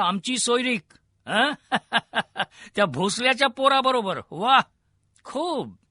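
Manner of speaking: high, mocking laugh through the words; bursting into laughter. A narrator's reading. Lord scoffs at the marriage proposal, laughing scornfully. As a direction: laugh incredulously as you speak. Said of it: "त्याला परत हसू फुटलं".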